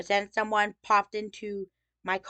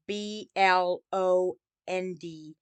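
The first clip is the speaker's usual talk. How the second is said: B L O N D spelled slowly, letter by letter, stopping on D; spelled out without E; much slower than usual. Shown as a demonstration. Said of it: "no E at the end".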